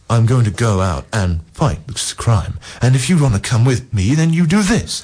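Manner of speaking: hammy, halting superhero declaration; William Shatner. Acted. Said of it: "that was a bit more William Shatner".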